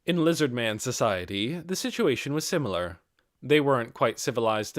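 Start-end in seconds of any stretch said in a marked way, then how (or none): none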